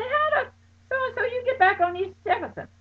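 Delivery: high-pitched alarmed question, near crying. Retold or imitated. Retelling asking her friend in shock, high alarmed cry. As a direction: squeal the question in disbelief.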